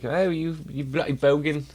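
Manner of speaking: put-on playful Australian-accented ribbing; mock Aussie accent. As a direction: jokingly heckle in fake Aussie. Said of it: "Aussie people come in".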